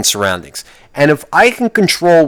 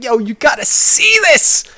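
high, slow, eager call to a passenger; mock excited; 11 semitones higher than usual. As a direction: nudge an imaginary friend to look. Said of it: "be like".

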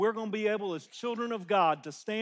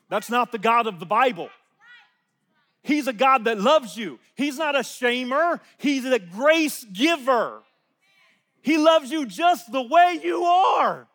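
loud, rising preacher's shout; preaching at full force; 6 semitones higher, 11 dB louder, slower than usual. Real, not acted. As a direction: build to a full-voiced shout of praise.

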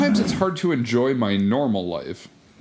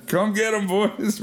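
calling out; 12 semitones higher, slower than usual. Pretend. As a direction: shout out to the zombies in a joking way. Calling zombies over, laughing.

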